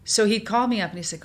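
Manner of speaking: ordinary talk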